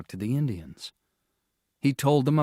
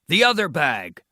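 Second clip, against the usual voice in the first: urgent demand; 5 semitones higher, 7 dB louder than usual. A narrator's reading. Father finds the ice melted.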